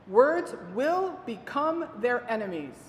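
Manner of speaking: slow, weighty emphasis on each word; a grave warning to caregivers; emphatic warning. Shown as a demonstration. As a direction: lean into Words and enemies slowly. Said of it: "Please hear that".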